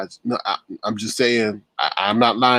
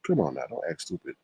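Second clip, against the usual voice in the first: hushed, unvoiced aside; whispered aside; 5 semitones lower, 8 dB quieter than usual. Real, not acted. Streamer whispering an aside to herself, hushed. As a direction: whisper it under your breath. Aside muttered under breath.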